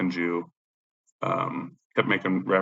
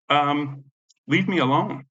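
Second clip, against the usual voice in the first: high-pitched fed-up protest; retold exasperated protest; 7 semitones higher, 4 dB louder, slower than usual. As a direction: replay telling a pest to back off. Retelling his plea to follower.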